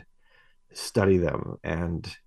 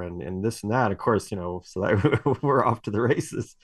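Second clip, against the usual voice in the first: usual speaking voice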